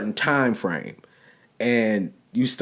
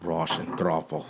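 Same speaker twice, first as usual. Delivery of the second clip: put-on low, slow Russian accent; a hint of a heavy Russian accent; 9 semitones lower, 9 dB quieter, slower than usual. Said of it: "in that Russian accent".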